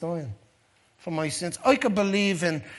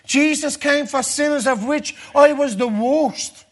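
loud, fervent preaching, pitched higher; preaching at full force; 7 semitones higher, 8 dB louder than usual. Acted. Preacher to his congregation, loud and fervent. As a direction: build the fire of a revival sermon.

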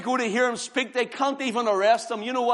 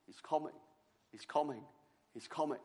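soft, low, fading repetition of he's coming; hushed repeat; 7 semitones lower, 16 dB quieter, faster than usual. Acted. Preacher to the congregation, hushed and fading. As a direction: let each he's coming drop softer.

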